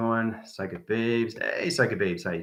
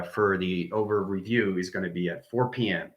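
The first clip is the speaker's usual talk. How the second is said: normal talking voice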